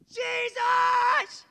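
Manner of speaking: high drawn-out scream to heaven; re-enacted bawling cry, pitch soaring. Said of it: "As loud as I can".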